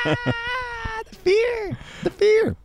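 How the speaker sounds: hammed-up falsetto shriek of fear; mock terror. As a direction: wail it like a horror victim.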